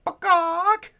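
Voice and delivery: very high, loud chicken squawk. Pretend. Warns kids they'll sound like this chicken, squawking. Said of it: "you sound like this chicken".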